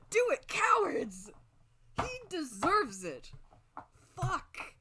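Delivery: high, drawn-out fan shout; excited demand. Real, not acted. Streamer daring the game's makers, excited shouting. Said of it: "I just got really excited".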